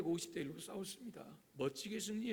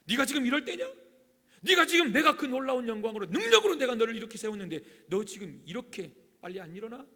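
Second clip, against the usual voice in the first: loud, high scolding; hammed-up angry scolding; mock rebuke; 5 semitones higher, 11 dB louder than usual. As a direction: berate him to get up. God hypothetically rebuking Elijah.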